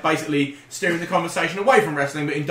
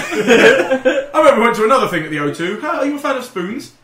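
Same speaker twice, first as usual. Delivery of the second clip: laughing parody; 5 semitones higher, 5 dB louder than usual. Pretend. Parodying the segue line.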